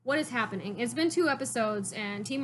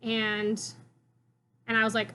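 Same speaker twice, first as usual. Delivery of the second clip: ordinary delivery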